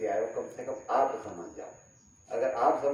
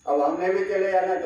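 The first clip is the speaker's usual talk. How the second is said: ordinary talk, nothing marked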